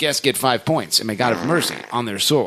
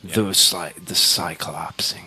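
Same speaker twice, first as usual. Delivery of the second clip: slow whispered mock movie title; whispered title reveal; 6 semitones lower, slower than usual. Host joking about an X-Men title, slow dramatic whisper. Announcing a made-up film subtitle.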